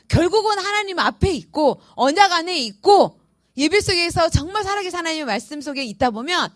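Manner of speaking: ordinary talk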